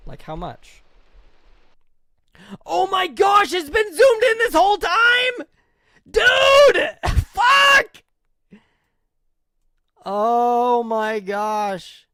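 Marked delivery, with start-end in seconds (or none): 2.7-8.0 much higher, yelling in real dismay; loud, high shriek of disbelief; shocked shout. Real, not acted. Realizes stream zoomed whole time. 10.0-12.1 dismayed groan. Real, not acted. Groaning at own streaming mistake.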